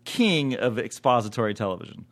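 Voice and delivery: usual speaking voice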